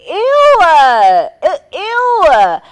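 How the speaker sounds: loud, high, drawn-out ews; disgusted. Real, not acted. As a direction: wail it out in exaggerated revulsion. Reacting in disgust to insinuation.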